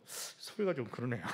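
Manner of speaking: chuckling at his own sound effect; amused laughing. Real, not acted. Preacher amused by his odd trumpet imitation, chuckling. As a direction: let a small laugh break into the words.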